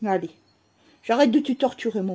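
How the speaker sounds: usual speaking voice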